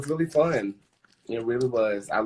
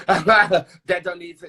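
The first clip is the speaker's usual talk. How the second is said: slow, loud, laughing through it; 6 semitones higher, 9 dB louder, much slower than usual. Real, not acted. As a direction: laugh the words out slowly and loudly. Laughing repeat.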